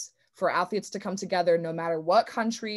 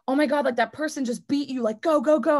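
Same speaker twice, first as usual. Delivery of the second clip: high, loud frantic inner voice urging go go go; frantic racing inner voice; panicked self-talk; 6 semitones higher, 4 dB louder than usual. Retold or imitated. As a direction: push yourself in a panic. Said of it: "instead of being like".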